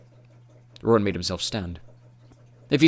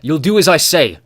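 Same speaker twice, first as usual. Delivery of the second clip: much louder, commanding snarl; growled command; 7 semitones higher, 12 dB louder than usual. A narrator's reading. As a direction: force the Urgle to obey. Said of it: "Roran growled".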